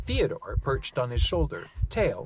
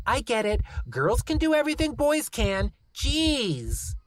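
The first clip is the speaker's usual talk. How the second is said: put-on high, exasperated kid grumble; grumbling; 12 semitones higher, 3 dB louder than usual. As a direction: grumble like a fed-up boy. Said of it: "Lucy grumbled".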